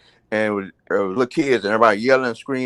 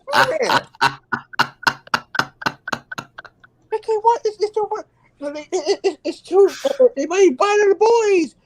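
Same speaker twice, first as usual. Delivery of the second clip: mock teacher voice. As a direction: whine it like a prissy substitute teacher. Teacher stammering objections.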